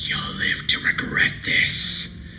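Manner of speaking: sinister whispered doll; slow eerie whisper threat; creepy doll threat. Acted. Doll's eyes glow red.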